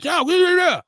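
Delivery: high, mocking preachy voice; sing-song mock sermon; mock preacher. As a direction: parody a pushy preacher droning on. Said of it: "I'm not preaching like that".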